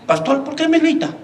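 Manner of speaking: lightly voiced high-pitched protest; a hint of Dominican imitation; imitated aggrieved churchgoer. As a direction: play a puzzled complaint to the pastor. Dominican man's hurt reply, imitated.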